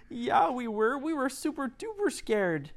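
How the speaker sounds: put-on very high, small child voice; high little kids. A narrator's reading. Trapped children explaining to rescuers, high child voice. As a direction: tell it like a frightened little kid.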